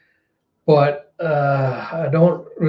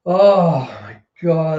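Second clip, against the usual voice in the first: ordinary talk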